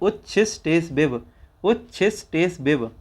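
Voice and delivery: उच्छिस टेस बिब repeated steadily; recitation drill. Shown as a demonstration. Teacher repeating उच्छिस टेस बिब for the class.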